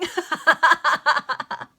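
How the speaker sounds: high loud burst of laughter. Real, not acted. Reader laughs at the line.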